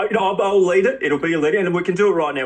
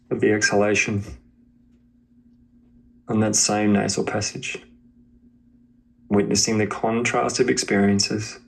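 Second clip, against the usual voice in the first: guided meditation; 4 semitones lower than usual. Acted. Teacher guiding a student to witness body and breath, meditation voice. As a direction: guide them gently into awareness of body and breath, leaving space between phrases.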